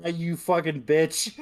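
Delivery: mock insult.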